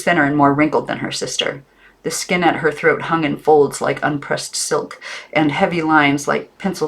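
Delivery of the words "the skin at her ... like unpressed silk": ordinary delivery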